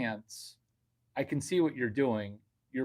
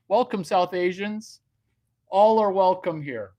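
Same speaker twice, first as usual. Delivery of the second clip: mock grand welcome; 7 semitones higher, 9 dB louder than usual.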